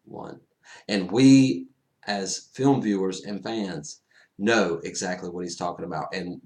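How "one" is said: barely audible, almost breath; silent mouthed word. Retold or imitated. Strange signalling Tony, a near-silent mouthed word. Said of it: "He just goes".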